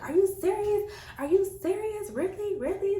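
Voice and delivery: high mock outrage from the boyfriend; mock disbelief. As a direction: squeak out his disbelief.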